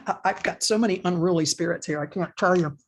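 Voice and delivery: normal talking voice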